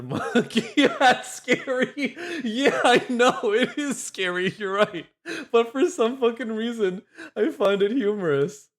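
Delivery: high, giggly talk; words bubbling over with laughs; laughing helplessly. Real, not acted. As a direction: concede the point through real laughter. Amused by the scary monkey video.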